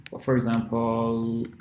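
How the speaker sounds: ordinary delivery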